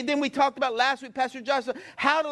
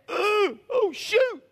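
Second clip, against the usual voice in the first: dismayed demons; 8 semitones higher, much slower than usual. Acted. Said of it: "demons went".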